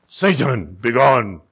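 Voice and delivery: low, firm casting-out; deep-voiced, controlled rebuke; authoritative command. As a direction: order the devil out in a deep voice. Replays the command from text.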